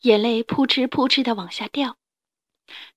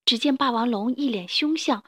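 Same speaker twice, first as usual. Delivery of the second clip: plain reading voice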